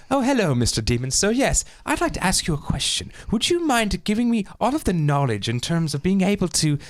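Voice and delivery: put-on high, overly polite English accent; mock posh English. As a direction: ask the demon sweetly in a posh English accent. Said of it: "he's English".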